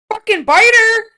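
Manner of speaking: high, loud incredulous yell; outraged retort. Retold or imitated. Telling the biter off in disbelief, loud high outrage. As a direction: shout it in disbelief, fast at the end. Retold outraged reply to partner.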